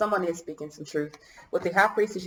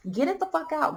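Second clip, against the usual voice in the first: frustrated outburst; 10 semitones higher than usual. Real, not acted. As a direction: pitch shoots way up in exasperation. Coughing, frustrated at her throat.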